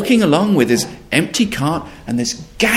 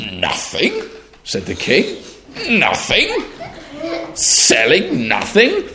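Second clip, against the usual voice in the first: incredulous king; 9 semitones higher, slower than usual. Acted.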